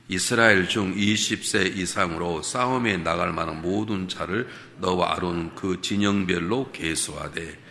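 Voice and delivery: slow, measured reading of the verse; scripture reading. Acted. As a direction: read the verse aloud with the church. Congregation reads scripture aloud together.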